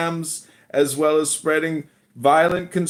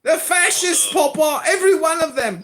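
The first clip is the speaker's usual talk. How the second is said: high put-on English child voice; son impersonating father; 12 semitones higher than usual. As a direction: scold Daddy as a high-voiced little English boy. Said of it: "You wanna hear one of my sons impersonate me?".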